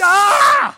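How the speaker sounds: sudden high, loud yelp; scream of pain. Real, not acted. Host zapped by the shock pen, screaming loudly.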